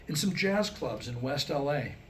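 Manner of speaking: usual speaking voice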